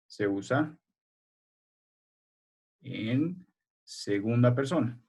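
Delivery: slow, spaced-out dictation for note-taking; dictating word by word. Shown as a demonstration. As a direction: spell it out slowly so they can write. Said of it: "Solo pongan".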